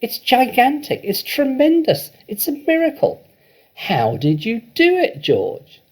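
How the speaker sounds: very high, loud, rising with joy; an overjoyed farmer dancing round and round; ecstatic shouting. A narrator's reading. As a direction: build the wonder word by word. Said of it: "Mister Cranky shouted".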